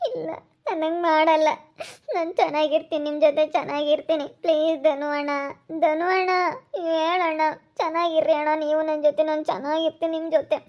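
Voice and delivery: acted crying, begging between sobs; tearful pleading. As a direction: plead through tears, fading at the end. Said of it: "ಕಣ್ಣೀರ್ ಹಾಕ್ಬೇಡ". (ಅಳುತ್ತಾ ಅಂಗಲಾಚುವ ಧ್ವನಿ)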